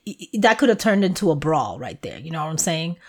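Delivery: normal talking voice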